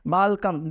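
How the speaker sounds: Balcom with a B sound for the W; mispronounced welcome. Retold or imitated. Teacher imitating a TV character's wrong Balcom. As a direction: say Balcom, B instead of W, imitating the mistake. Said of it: "she pronounced, in very different manner".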